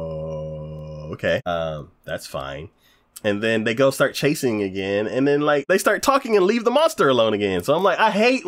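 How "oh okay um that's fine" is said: low, soft, drawn-out deadpan; underwhelmed acceptance. Acted. Viewer reacting to some backstory, underwhelmed and deadpan. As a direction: go along with it, barely convinced.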